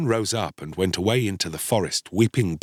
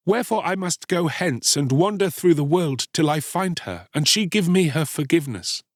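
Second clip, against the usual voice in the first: put-on higher-pitched earnest boy; penitent Star-Child; 8 semitones higher than usual. A narrator's reading. Boy explaining his quest for forgiveness, penitent. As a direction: own the guilt, resolve to go.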